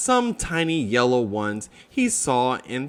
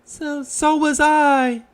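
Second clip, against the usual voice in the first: high little Piglet voice, stammering; small nervous piglet, pitched up; timid Piglet; 11 semitones higher than usual. Acted. Piglet answers Roo, high and shy.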